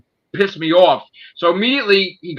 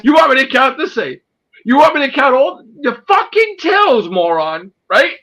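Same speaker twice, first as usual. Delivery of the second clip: much higher, loud, near-crying shout; furious rant; 12 semitones higher, 4 dB louder than usual. Real, not acted. Raging at the investigator who called him a thief, shouting. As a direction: yell it out, voice cracking with outrage.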